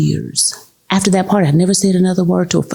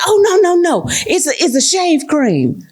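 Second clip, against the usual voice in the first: higher, hurried saleslady; higher, mock-flustered consultant; flustered correction; 10 semitones higher than usual. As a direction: backpedal quickly.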